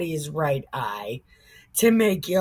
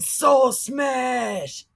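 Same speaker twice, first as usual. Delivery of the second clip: higher caveman shout; lumbering Hulk shout; Hulk-style Saul; 5 semitones higher than usual. Retold or imitated. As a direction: shout it like a brute hero. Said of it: "He was like".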